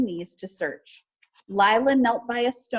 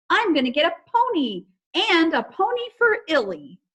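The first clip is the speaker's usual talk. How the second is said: put-on high, louder, bubbly child voice; excited; 8 semitones higher, 5 dB louder than usual. Izzy dancing and planning ponies, high and excited. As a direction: babble out your wish list.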